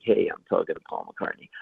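low, cool, unfazed delivery; smooth and hip. Acted. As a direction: play it super smooth on the outside. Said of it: "I'm super smooth and hip".

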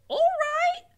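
put-on very high, loud simple girl; high Mig voice. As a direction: chirp back obediently. Mig answers cook.

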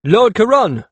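loud, high-pitched hail; urgent servant. A narrator's reading. Servant pounding up to Lord Karun, calling urgently. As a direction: call out to your lord. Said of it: "Yet another servant came pounding up".

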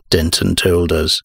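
ordinary delivery

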